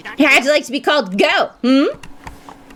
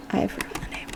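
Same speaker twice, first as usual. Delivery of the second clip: whisper; 14 dB quieter, faster than usual. Real, not acted. Whispered aside.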